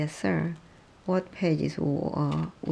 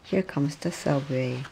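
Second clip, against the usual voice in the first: ordinary delivery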